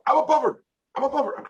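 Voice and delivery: high-pitched attempt at British catchphrase; high, imitated British accent; mimicked British catchphrase. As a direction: put on a high, clumsy British voice. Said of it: "she says".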